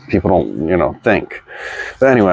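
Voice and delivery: ordinary talk, nothing marked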